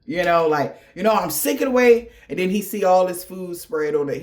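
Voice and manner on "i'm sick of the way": higher, louder huff; mock huffy boyfriend, higher and louder; huffy complaint. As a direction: stomp in, huffing about it. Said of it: "he come down the steps all in a huff".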